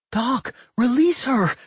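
high, urgent cry of panic; panicked cry. A narrator's reading. Rowan begs Doc to release the engulfed girl, panicked. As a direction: cry out in alarm, high and drawn out. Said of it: "Rowan cried out in panic".

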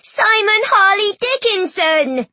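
high, slow, stretched-out full-name cry; joyful yell. A narrator's reading. Children running to hug their friend, joyful yell. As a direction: sing out each name in delight. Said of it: "incorrigibles yelled and ran to hug".

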